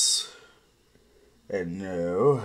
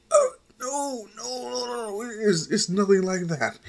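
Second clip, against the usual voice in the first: high, flustered no no no; panicked to deny a crush, pitched up high; flustered denial; 13 semitones higher than usual. Acted. Flustered denial about the girl.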